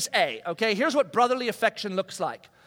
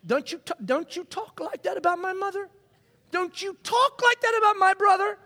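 protective sibling; 10 semitones higher than usual. Retold or imitated. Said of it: "You're like".

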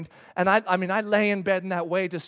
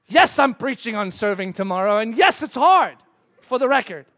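loud, indignant comeback; acted fed-up protest; exasperated retort; 8 dB louder than usual. Dad retorts crossly to God.

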